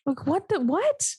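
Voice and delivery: high, incredulous double take; baffled disbelief. Real, not acted. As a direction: pitch jumps up in bewilderment. Baffled by the casting choice.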